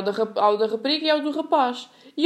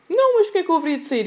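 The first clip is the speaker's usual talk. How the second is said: higher, louder and quick, puzzled; mock a dad who has no idea why he should leave; baffled dad; 8 semitones higher, 4 dB louder, faster than usual. Dad on the phone, puzzled, asking why he would leave.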